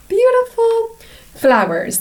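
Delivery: high, quick "Beautiful"; delighted. Acted. Admiring the flowers for the children, high and delighted. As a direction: gush over the flowers.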